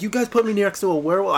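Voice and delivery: panicked, indignant movie-quote imitation; freaking out. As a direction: imitate someone freaking out, voice jumping up. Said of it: "then he freaks out".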